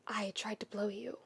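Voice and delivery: hushed, breathy whisper of shame; whispered confession. Acted. Keith confessing to Shiro in a whisper. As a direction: barely voice the words.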